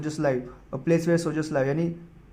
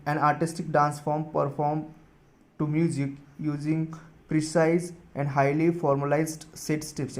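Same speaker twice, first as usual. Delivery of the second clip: slow dictation; much slower than usual. Shown as a demonstration. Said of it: "dictating definition slowly".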